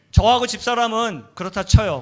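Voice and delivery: suddenly loud, pressing the point; loud outburst. Real, not acted. Preacher raising his voice to the congregation, loud. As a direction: burst out with the complaint.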